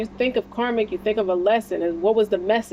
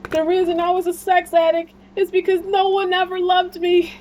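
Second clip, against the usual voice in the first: much higher, put-on comic voice for the Blades of Glory guy; quoting a movie character; 10 semitones higher, 4 dB louder than usual. Retelling a Blades of Glory scene to viewers, high comic character voice. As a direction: ham up the character's earnest confession, pitched way up. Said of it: "and he was like".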